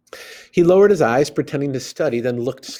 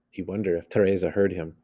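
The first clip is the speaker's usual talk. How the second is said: usual speaking voice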